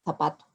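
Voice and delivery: zapato with a Spain-style th sound, θapato; Spanish lisped z. Shown as a demonstration. Demonstrating to students the interdental zapato they don't use. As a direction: say zapato the way Spaniards do, tongue between the teeth on the z. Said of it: "el fonema interdental de los españoles".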